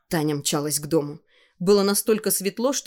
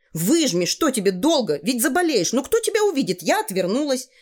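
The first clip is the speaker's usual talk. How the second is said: put-on high, insistent girl's voice; bossy girl, urging; 6 semitones higher than usual. Tanya urges the shy boy to wring his trousers, impatient. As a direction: nag him with rising impatience.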